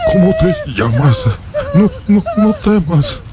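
put-on high, urgent pleading to calm the child; trying to soothe a scared boy, voice straining high; pleading, reassuring. Creature begs the frightened boy not to be scared, high and pleading.

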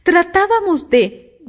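high, slow, humble explanation. A narrator's reading. Kneeling Two explains himself to the Queen, humbly. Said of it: "con tono muy humilde".

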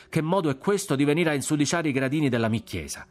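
high-pitched, indignant scolding; friar's worked-up telling-off; heated shouting. A narrator's reading. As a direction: rant while driving them off with blows. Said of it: "menava colpi e gridava".

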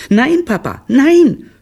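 put-on very high, loud kids' protest; children calling out. A narrator's reading. Children contradicting their father, shrieked out high. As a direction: shout it like excited little children. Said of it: "riefen da seine Kinder".

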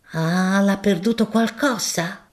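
a deferential, earthy shopkeeper making his offer; grocer Gaudenzio. Acted. Gaudenzio misunderstands.

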